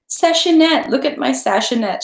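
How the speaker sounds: high, loud, delighted cry; laughing hostess. Acted. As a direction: burst out fondly at the costume. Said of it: "she said".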